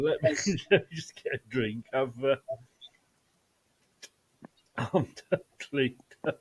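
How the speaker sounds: soft, breathless words between laughs; recovering from laughing so hard you cried. Real, not acted. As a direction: gasp it out after a fit of laughter. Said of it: "I literally was crying then".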